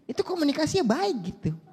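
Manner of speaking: ordinary delivery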